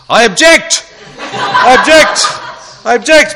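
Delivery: high, loud, laughing "I object!"; playful courtroom cry, laughing; mock law student. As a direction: ham up a squealing objection. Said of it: "lawyer students are all going".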